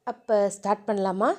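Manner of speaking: low, soft husky question. A narrator's reading. Dheeran asking Diya in bed, low and husky. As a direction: lean in close and ask it huskily. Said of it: "Dheeran husky வாய்ஸில் கேட்கவும்".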